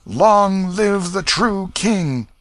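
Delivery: high, loud, slow "Long live"; a drawn-out acclaiming shout; crowd's shout. A narrator's reading. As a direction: shout it out slowly like a cheering crowd. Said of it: "such a shout went up".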